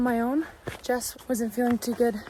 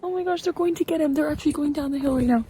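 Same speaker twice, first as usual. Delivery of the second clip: fast, high excited commentary; 5 semitones higher, faster than usual. Real, not acted. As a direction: rattle it off in suspense. Watching sheep rescue lamb.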